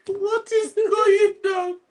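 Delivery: mock surprise. Retold exclaimed reaction.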